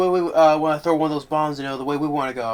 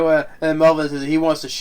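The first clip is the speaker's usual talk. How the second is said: normal talking voice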